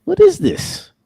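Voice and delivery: very high, loud outraged squeak; squealing disbelief. Real, not acted. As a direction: squeal it in shock. Repeats in even higher squeal.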